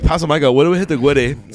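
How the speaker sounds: "Gwitty" with W for R; goofy W sound in place of R in "Gwitty". Retold or imitated. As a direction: imitate a silly babyish impediment on "Gwitty". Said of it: "everyone started doing it".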